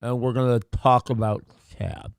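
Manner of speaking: numb tongue, thick speech; numbed throat. Real, not acted. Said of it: "I can't feel my tongue".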